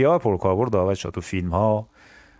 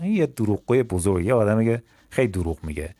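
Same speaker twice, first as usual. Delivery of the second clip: ordinary delivery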